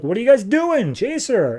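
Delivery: high-pitched, exasperated command; mock frantic order. Character orders his constructs after her.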